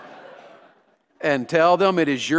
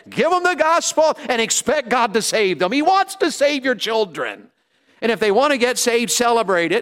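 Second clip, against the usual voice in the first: preach; 7 semitones higher, 4 dB louder than usual. Acted. Preaching to the congregation.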